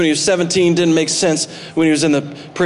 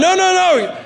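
Joseph insisting; 10 semitones higher than usual. Retold or imitated. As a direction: press the point to frightened brothers. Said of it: "he's gotta remind them later on".

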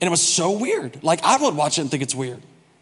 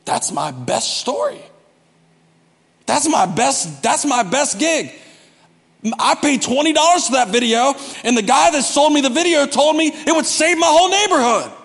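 exasperated complaint climbing higher and louder; acted frustration; 7 semitones higher, 3 dB louder than usual. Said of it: "So I'd get frustrated and I'd be like".

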